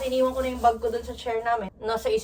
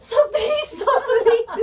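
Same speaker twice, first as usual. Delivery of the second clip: laughing quote; 9 semitones higher, 5 dB louder, much slower than usual. Retold or imitated. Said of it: "sabi ni Ate Jel".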